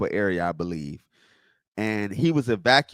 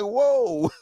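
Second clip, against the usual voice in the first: mock surprise; 11 semitones higher than usual. Reacting to a rude comment.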